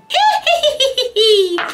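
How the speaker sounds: high giggle burst; high laugh. Real, not acted. Laughs at her own brows, high giggle. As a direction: cackle at yourself.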